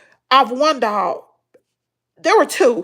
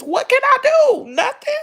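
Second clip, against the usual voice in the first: tearful; 12 semitones higher than usual. Real, not acted. Emotional about living with PTSD.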